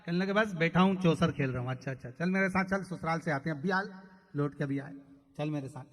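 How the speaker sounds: loud, driving preacher's storytelling; loud sermon storytelling voice; full-force preaching. Acted. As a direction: preach it out to the crowd.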